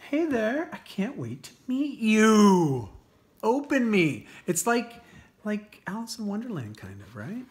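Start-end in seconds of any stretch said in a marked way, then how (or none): 0.1-4.3 high, slow, eager product voice; playful gadget voice. Pretend. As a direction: let the box beg to be opened. Voicing earbuds eager to be opened.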